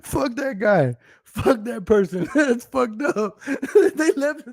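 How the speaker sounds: high-pitched, laughing through the words. Real, not acted. Streamer about a viewer who left, cracking up. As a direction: curse the deserter while cracking up.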